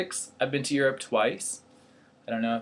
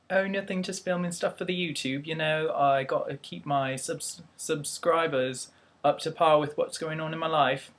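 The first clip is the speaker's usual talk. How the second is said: higher-pitched put-on British accent; playful mock British accent, pitched up; 5 semitones higher than usual. Shown as a demonstration. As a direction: chat away in an amateur British accent. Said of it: "British. Be like".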